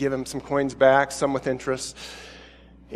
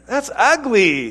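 higher, slow, laughing through the words; laughing disapproval; 6 semitones higher, slower than usual. Real, not acted. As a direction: chuckle it out in disgust. Reacting to the mock show-off.

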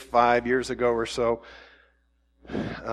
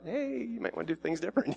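high, soft, coaxing mock plea; lamb-like gentleness; 6 semitones higher, 11 dB quieter than usual. Playing someone sent like a lamb, softly coaxing. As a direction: sidle up softly with a pitched-up nudge. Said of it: "come in beside them and snuggle up".

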